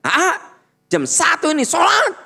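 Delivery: shouting parent; yelling. Shown as a demonstration. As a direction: shout up the stairs at the child. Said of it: "Coba lamun teriak".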